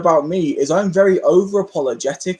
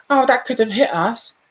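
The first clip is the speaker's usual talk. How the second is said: mocking whiny voice; 5 semitones higher than usual. Mimics careless parents.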